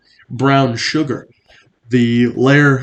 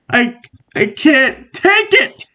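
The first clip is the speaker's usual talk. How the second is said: mock breaking point; 11 semitones higher, slower than usual. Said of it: "I'm at my breaking point".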